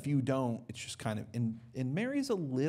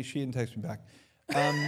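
ordinary delivery

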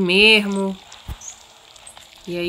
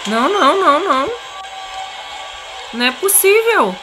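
panicked; 7 semitones higher than usual. Real, not acted. Monster appears, panicking.

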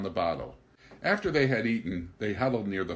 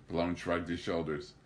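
usual speaking voice

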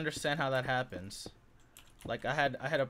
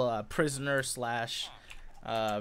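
ordinary talk, nothing marked

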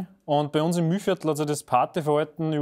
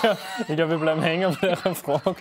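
laughing. Real, not acted. Interview derails into giggles.

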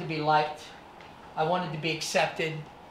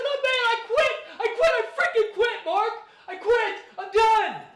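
much higher, louder, frantic pleading with a nervous laugh; panicked surrender; 7 dB louder than usual. Retold or imitated. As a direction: beg the bully to stop, half hysterical. Kid giving up while beaten.

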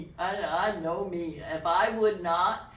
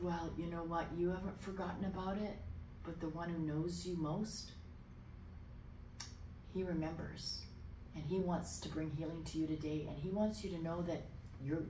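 soft, sincere, consoling voice; quiet pastoral tenderness; gentle assurance; 8 dB quieter than usual. Retold or imitated. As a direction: speak softly and earnestly to comfort him. Retold comfort to crying man.

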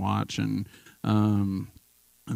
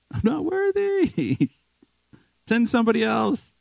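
much higher, slow hammed-up whine; mock whiny plea; 4 dB louder than usual. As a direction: whine like a self-pitying wimp, half laughing. Mock self-pitying plea to God.

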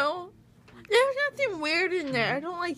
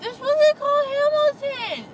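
shouting; 12 semitones higher, 8 dB louder than usual. Real, not acted. Insisting loudly.

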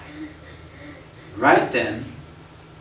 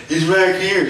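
raised, higher mock call of a churchgoer giving him away; tattling congregant; 6 semitones higher, 4 dB louder than usual. Churchgoers pointing him out to the pastor, higher mock call. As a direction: call it out like a kid telling on someone. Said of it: "They're like".

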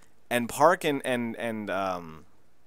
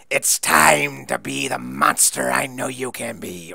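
menacing manager; 4 dB louder, slower than usual. Retold or imitated. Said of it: "he's like".

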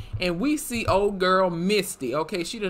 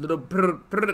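motor noise; faster than usual. Retold or imitated. Imitating boat motor.

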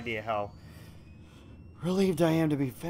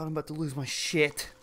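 normal talking voice

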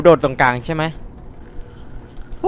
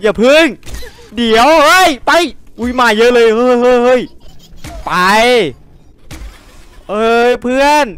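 high, loud frightened shouts; panicked yelling; 9 semitones higher, 5 dB louder than usual. Real, not acted. Streamer fleeing a pack of creatures, yelling in panic.

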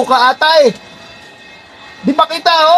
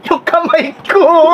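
high, slow, drawn-out wail; comic cry of pain, words stretched; pained shout; 5 semitones higher, much slower than usual. Pretend. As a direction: wail it out as if your hand is hurt. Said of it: "ba't ikaw yung sumisigaw".

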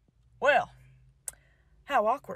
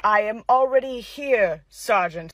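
deep, slow commanding officer; low and unhurried authority; stern colonel; 7 semitones lower than usual. Acted.

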